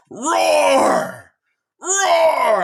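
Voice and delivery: loud, high mock lion roar. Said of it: "I'm gonna be playing the roar sounds".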